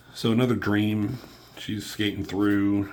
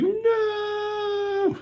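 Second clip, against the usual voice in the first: high wailing no; hammed-up high wail; mock cry. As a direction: squeal it like a crybaby. [name] cries out dramatically.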